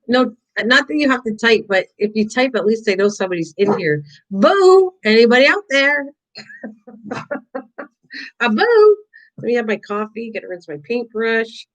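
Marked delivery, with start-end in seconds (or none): 4.3-4.9 high, loud mock boo; playful boo. Calling to a silent live chat, a loud playful boo. 5.0-6.1 calling out. Real, not acted. Calling out to silent chat. 8.4-9.0 high, loud mock boo; spring a playful boo on them. Calling to a silent live chat, a loud playful boo.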